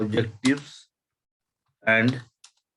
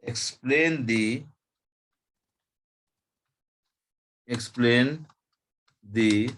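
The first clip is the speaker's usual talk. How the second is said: slow dictation; much slower than usual. Shown as a demonstration. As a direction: explain the types and strategies, drawn out in pieces. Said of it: "तो मैं slow slow ही बोल रहा हूँ". (धीरे धीरे लिखवाना)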